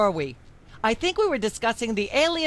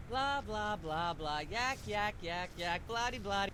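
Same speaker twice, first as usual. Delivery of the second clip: background chatter; 14 dB quieter, slower than usual. Acted. Myra's endless chatter in background.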